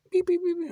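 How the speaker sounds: high beeping notification noise mimicked; beep imitation. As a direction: imitate phone alert beeps. Phone notification sound imitation.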